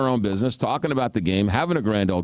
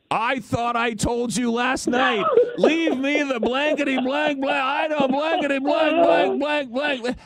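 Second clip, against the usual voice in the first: mock angry tirade; 12 semitones higher than usual. Umpire handed the phone on air, mock furious tirade. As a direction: go off like an enraged umpire, hammed-up. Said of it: "he goes off on me".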